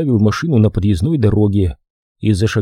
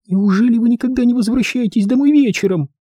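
put-on high, small complaining girl's voice; plaintive little voice; 13 semitones higher than usual. A narrator's reading. Shivering girl complains to Holman, plaintive. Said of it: "послышался жалобный негромкий голосок".

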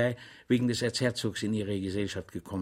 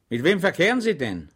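higher, louder probing; interrogator; 5 semitones higher, 5 dB louder than usual. A narrator's reading. Official interrogating Schweig, higher and louder. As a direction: press the question.

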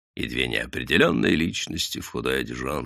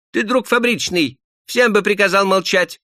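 высоко и громко, «друг» с ударением; упрекни рабочего громко; громкий упрёк; 6 dB louder than usual. Acted. Мужик спорит с рабочим. (мужик громко упрекает рабочего)

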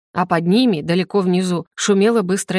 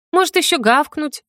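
high-pitched, affronted retort; indignant sarcasm; 7 semitones higher than usual. A narrator's reading. Heroine ordered about like a dog, indignant. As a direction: snap back in indignation. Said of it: "возмутилась я".

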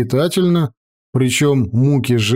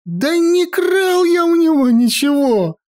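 wailing protest. Acted. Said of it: "завопил маэстро".

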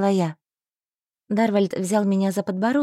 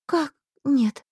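voice breaking; 3 semitones higher than usual. Acted. Heroine heartbroken.

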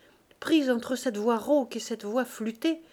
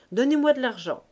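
louder, higher vendor's call; 4 semitones higher, 7 dB louder than usual. Acted. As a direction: call out to close the sale. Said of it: "lui cria la poissonnière".

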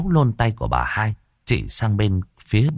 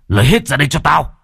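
higher, louder raging yell; furious shout; 7 semitones higher, 5 dB louder than usual. Acted. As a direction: bellow "Lấy hết ra đây cho tao!". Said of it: "mà rít lên". (quát to giận dữ)

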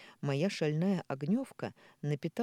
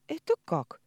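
high surprised question; bewildered, pitch jumps; puzzled; 12 semitones higher, 5 dB louder than usual. A narrator's reading. Confused question, eyes blinking.

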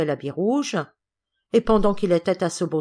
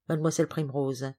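ordinary delivery, nothing marked

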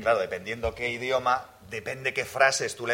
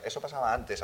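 ordinary talk

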